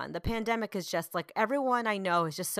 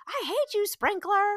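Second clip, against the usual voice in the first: high-pitched mock tantrum, lifting at the end; raging small child; 12 semitones higher, 3 dB louder, slower than usual. Mom mimics her little one at the fallen sprinkler, outraged. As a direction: mimic a little kid cursing an object. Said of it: "gets him out of his rage".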